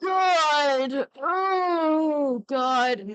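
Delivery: loud, drawn-out "oh God"; dismayed outcry. Real, not acted. Streamer reacting to what they just heard, dismayed loud groan.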